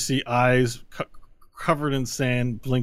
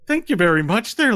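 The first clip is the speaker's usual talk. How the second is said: higher, louder Irish lilt; lightly Irish leprechaun; 9 semitones higher, 6 dB louder than usual. Acted. Leprechaun thanking his rescuer in a light Irish lilt. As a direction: thank him with a cheery brogue.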